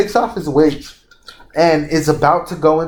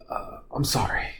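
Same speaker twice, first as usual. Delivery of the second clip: nervous whispered apology; 13 dB quieter than usual. Retold or imitated. Kankuro sweating, caught by Naruto.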